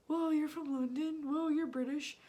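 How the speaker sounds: lightly imitating impressed strangers; mock amazement. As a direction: play the wowed stranger. Voices impressed strangers online.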